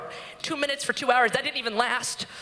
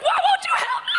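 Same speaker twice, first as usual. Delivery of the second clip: very high hammed-up shriek; piercing, acted plea; desperate scream; 3 dB louder, faster than usual. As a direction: scream for help as if in labor. Retold labor outburst at nurse.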